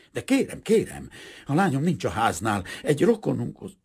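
high, loud pleading; flustered protest. A narrator's reading. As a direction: protest urgently while being grabbed. Merchant protests while grabbed.

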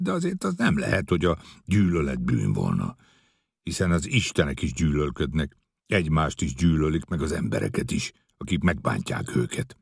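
ordinary delivery

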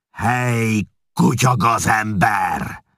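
raging chieftain roaring at the scribe; furious roar. A narrator's reading. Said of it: "ordította olyan hangon".

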